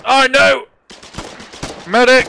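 loud, high cry for a medic; man down shout. Real, not acted. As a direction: yell for help as you go down. Hit, calling for medic.

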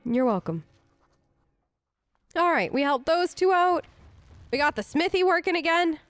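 ordinary delivery

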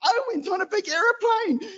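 put-on high, bouncy little-boy voice; excited toddler. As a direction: chirp it like a thrilled three-year-old. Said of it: "just bouncing around going".

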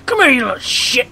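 high, loud taunting yell; mock-angry shout. Player chasing a game enemy, mock-angry shout. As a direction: holler after a fleeing enemy.

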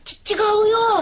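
tearful protest. Acted. Bullied boy protests tearfully.

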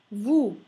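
"vous" loud, rhyming with "too", silent S. Shown as a demonstration. Teacher models French OU, loud "oo" vowel. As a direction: punch out "vous" as a loud voo. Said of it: "O U si pronuncia U".

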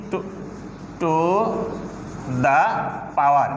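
To, to, the, power said word by word with pauses; slow teacher demo of "to the power"; spelled-out pronunciation. Shown as a demonstration. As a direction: break "to the power" into separate words. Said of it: "এখানে উচ্চারণে কি?".

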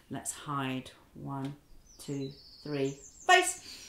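ordinary delivery

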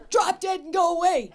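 high, loud laughing blurt; gleefully rude; laughing outburst. Real, not acted. Blunt joke answer, laughing.

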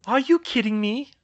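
high, loud, indignant outburst; protesting. A narrator's reading. Georgina objects to Hermione's calm, loud high protest. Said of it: "Georgina protested".

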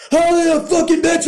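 hammed-up screaming insult; mock yell. Husband yelling at his wife, mocking the scream.

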